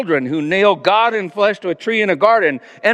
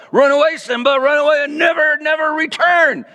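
higher, rising to a shouted never return; villain shouting the cub out of the pride; snarling shout; 10 semitones higher than usual. A narrator's reading. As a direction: drive the cub away with a yell. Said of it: "Scar snarled".

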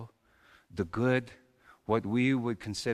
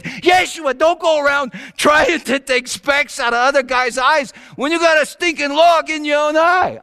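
mock scolding; 12 semitones higher, 9 dB louder than usual. Scolding son in workshop.

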